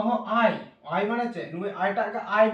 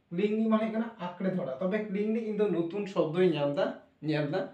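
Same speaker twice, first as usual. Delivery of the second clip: normal talking voice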